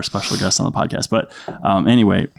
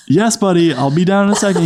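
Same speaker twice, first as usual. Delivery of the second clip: high, lilting parent reply; dad calling down; 8 semitones higher than usual. Retold or imitated. Dad upstairs reassuring his son, high calling voice. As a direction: call back to a little kid, pitched up.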